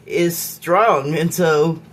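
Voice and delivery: normal talking voice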